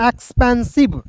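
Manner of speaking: ordinary talk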